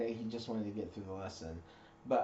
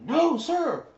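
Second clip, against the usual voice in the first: high, loud unison reply; platoon sounding off; 12 dB louder than usual. Retold or imitated. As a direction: shout it back as a platoon.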